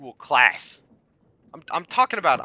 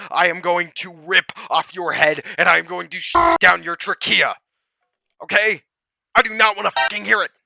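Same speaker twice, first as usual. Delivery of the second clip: higher and louder, spitting every threat; furious rant; 5 semitones higher, 4 dB louder, faster than usual. Real, not acted. Ranting at fluoride believers, furious and loud. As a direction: lose your temper at them, fast and loud.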